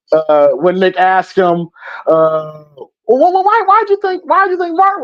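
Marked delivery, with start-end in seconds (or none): 3.1-5.0 high, stammering question. Retold or imitated. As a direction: ask it stammering and curious. Imitating Nick asking old man.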